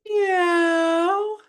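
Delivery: very high squeaky cat meow; a tiny kitten mewing up high; kitty meow. Shown as a demonstration. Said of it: "Say that with me".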